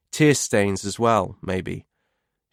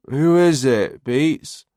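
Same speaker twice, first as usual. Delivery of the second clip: put-on slurring tipsy teen; slurred drunk; 4 semitones higher, 4 dB louder, much slower than usual. A narrator's reading. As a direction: drawl it out drunk. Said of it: "a slurred male voice asks".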